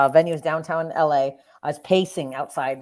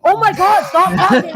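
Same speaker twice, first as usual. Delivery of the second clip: high, loud horrified outburst; retold mortified panic; 13 semitones higher, 11 dB louder than usual. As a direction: cry out in hammed-up embarrassment.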